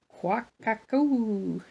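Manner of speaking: slow, drawn-out imitated bird call; mock quail quack-a-coo; quail call imitation. Shown as a demonstration. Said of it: "I don't know if I said that right".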